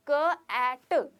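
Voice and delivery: क एट split and drawn out very slowly; sounding out cat. Shown as a demonstration. Teacher sounds out क एट for students, very slowly. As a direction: break कैट into क and एट, slowly. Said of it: "तो हम बोलते हैं कैट".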